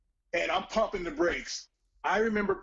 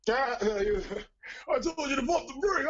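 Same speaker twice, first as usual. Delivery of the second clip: a hint of Tovar, somewhat higher, exasperated; Tovar impression again; 6 semitones higher than usual. Shown as a demonstration. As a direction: scold like the fed-up mechanic friend. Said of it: "Do that again".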